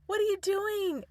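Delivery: high-pitched mock disbelief; re-enacted exasperated question in a high voice; incredulous challenge. Re-enacts challenging her husband.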